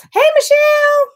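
high, loud sing-song hello; bright greeting. Real, not acted. Welcoming a viewer into the live stream, high and sing-song. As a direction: call out a happy welcome.

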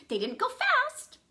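plain reading voice

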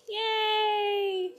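high bright yay; excited cheer. Real, not acted. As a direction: cheer at the samples. Unboxing her samples, cheering.

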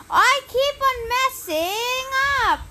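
high, loud and slow frustrated whine; frustrated wail. Real, not acted. Player fed up with own mistakes, high frustrated wail. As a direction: let the annoyance spill out.

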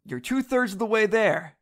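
pep talk to a queasy buddy; encouraging. Acted. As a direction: egg your friend on. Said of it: "I said encouragingly".

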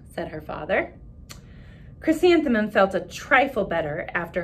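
put-on expressive read-aloud for children; story narration. A narrator's reading. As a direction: read the picture book to the class with voices. Reads picture book aloud to class.

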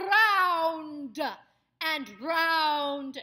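loud ROUND with a long trilled R; rolled R. Shown as a demonstration. Coach shows actors the R's, ROUND loudly trilled. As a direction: roll the R hard on each ROUND and project it. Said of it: "you can do the R's too".

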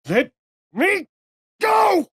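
loud strained cry rising high; pained shout. Acted.

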